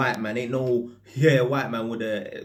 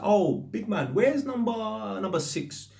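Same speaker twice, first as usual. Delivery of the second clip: calling up; 10 semitones higher than usual. Retold or imitated. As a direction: shout the question up to a window. Calling up to man at window.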